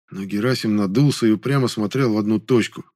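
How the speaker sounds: ordinary delivery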